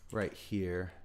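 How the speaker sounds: ordinary talk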